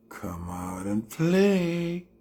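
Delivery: slow, drawn-out sing-song taunt; creepy taunt. Acted. Slowly taunting Kartel to come out and play. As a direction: lure him out like a playground bully.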